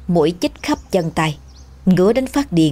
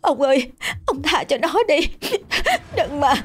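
higher, pleading wife's voice; begging; 9 semitones higher than usual. Acted. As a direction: beg him to spare the child. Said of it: "van xin".

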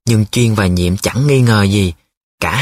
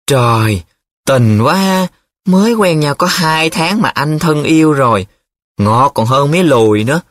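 higher, impressed teasing; gushing commentary; 5 semitones higher than usual. A narrator's reading. Nhiệm comments on his friend's love letter, gushing. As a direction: gush over it with envy. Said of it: "Nhiệm đã hít hà bình luận".

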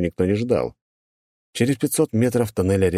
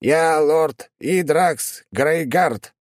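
high, very slow grand self-announcement; pompous demon; 10 semitones higher, much slower than usual. Acted. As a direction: proclaim your title like a tiny lord.

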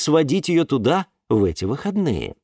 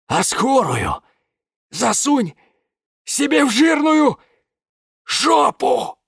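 wheezing; 12 semitones higher, 4 dB louder, slower than usual. A narrator's reading. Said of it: "просипел [name]".

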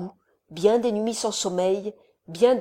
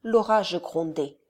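plain reading voice

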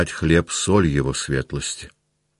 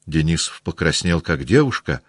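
usual speaking voice

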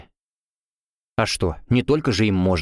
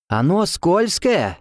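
slow high warning cry; 8 semitones higher, much slower than usual. A narrator's reading. Olua warns about the slippery log, slow high cry. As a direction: shout a warning, drawn out. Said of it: "услышали мы крик Олуа".

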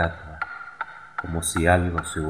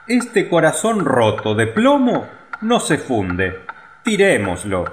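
foreman; 8 semitones higher, 4 dB louder than usual. A narrator's reading. Foreman voiced.

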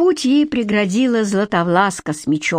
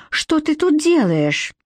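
surprised question; 7 semitones higher, slower than usual. Acted. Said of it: "воскликнула Златовласка".

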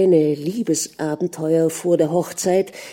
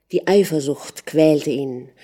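ordinary delivery, nothing marked

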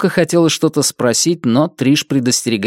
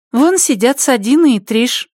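put-on young girl voice; 11 semitones higher than usual. Mioka points them out.

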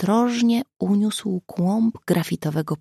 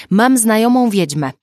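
loud, raised, playful announcement; amused; 5 semitones higher, 8 dB louder than usual. A narrator's reading. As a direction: toss it in with amusement. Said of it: "podrzucił Mateusz z rozbawieniem". (z rozbawieniem, głośno)

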